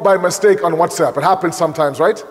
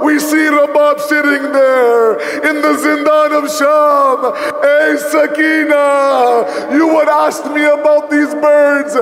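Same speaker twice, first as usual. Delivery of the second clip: preaching at full force; 5 semitones higher than usual. Acted. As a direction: climb into the lament and cry it out to the mourners. Majlis climax, preaching at full force.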